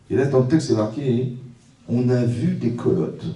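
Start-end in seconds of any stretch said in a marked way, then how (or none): 1.9-3.3 slow, weighty. Shown as a demonstration. Rabbi slowly reading the verse to the congregation. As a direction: draw out each word slowly. Rabbi quoting Torah text.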